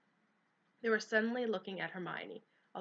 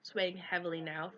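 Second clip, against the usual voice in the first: ordinary delivery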